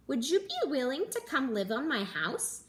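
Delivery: plain reading voice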